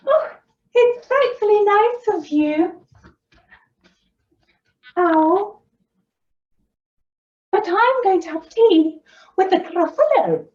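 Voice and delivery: a tiny mouse acting posh; little mouse voice. Mouse voiced, high and bright.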